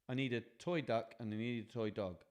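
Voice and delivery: plain reading voice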